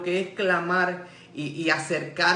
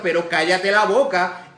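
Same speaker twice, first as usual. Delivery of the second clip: scolding; 7 dB louder than usual. Retold or imitated. Crowd rebukes the blind man.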